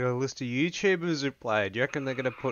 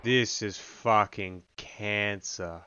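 normal talking voice

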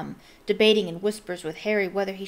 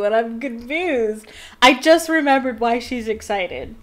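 real high, loud burst of delight; excited realization; 5 semitones higher, 8 dB louder than usual. Real, not acted. Reader remembering why she's excited, genuinely excited outburst. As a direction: blurt it out, thrilled to have remembered.

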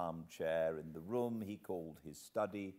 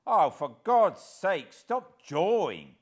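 much louder and higher, slowed on stop jawing; exasperated cry; 11 semitones higher, 15 dB louder than usual. Acted. Hero acting a tramp, loud desperate cry. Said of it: "I cried".

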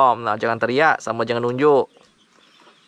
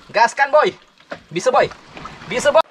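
excited cheering; 11 semitones higher than usual. Real, not acted. Vlogger urges his friend up the tree, excitedly cheering. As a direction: cheer your buddy on, pitched way up.

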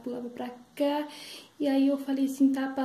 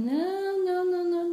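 reluctant refusal; 8 semitones higher, 4 dB louder than usual. Acted. Granny refuses to go up.

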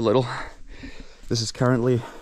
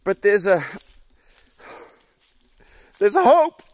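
high, loud mock-grand declaration; elated proclamation; 10 semitones higher, 9 dB louder than usual. Real, not acted. Tired hiker nearing the car, grand elated cry. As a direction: proclaim it like a preacher.

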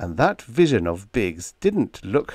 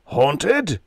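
roar; 7 semitones higher than usual. A narrator's reading. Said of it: "roared Hanson".

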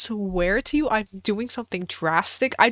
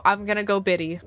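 ordinary talk

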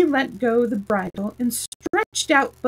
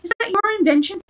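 cheerful; 10 semitones higher, 3 dB louder, faster than usual. Acted. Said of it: "she said cheerfully".

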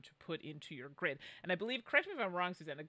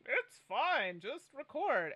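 high, breezy brush-off; retold impatient reply, higher and louder; mock dismissive; 7 semitones higher, 4 dB louder, much slower than usual. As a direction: wave it away in a raised voice. Retelling own dismissive reply.

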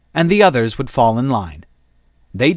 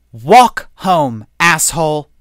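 angry; 5 semitones higher, 6 dB louder, slower than usual. Acted. Shu fires the airlock.

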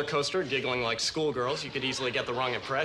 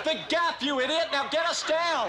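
angry yell; 11 semitones higher than usual. Acted. Yelling up at the operator.

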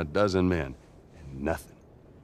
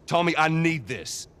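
pleading; 8 semitones higher, 3 dB louder, slower than usual. Acted. Brother pleading after argument.

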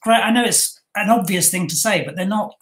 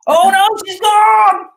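loud, much higher, drawn-out alarm; mock panic; 12 semitones higher, 8 dB louder, slower than usual. Real, not acted. Host sees the guest vanish from the call, mock-panicked shout. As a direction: cry out in mock dismay.

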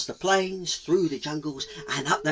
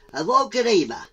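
loud, high repeat of the word; high-pitched tortoise exclamation; eager tortoise; 8 semitones higher, 7 dB louder than usual. Acted.

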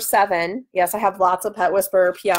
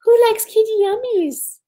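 pet-talk to cat; 12 semitones higher, 4 dB louder than usual. Real, not acted. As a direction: coo it up high like talking to a kitten. Cooing at cat with treats.

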